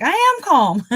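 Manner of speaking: high, loud insistence on being calm; excited-sounding protest. Retold or imitated. Driver insisting to a cop, excited-sounding. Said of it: "it sounds like I'm getting excited".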